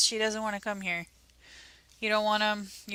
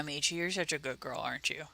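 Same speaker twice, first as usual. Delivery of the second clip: tender praise; 2 semitones lower, 7 dB quieter, faster than usual. Real, not acted. Praising the dog softly.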